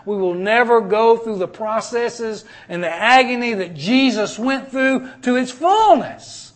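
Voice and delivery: ordinary talk, nothing marked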